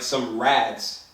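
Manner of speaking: ordinary talk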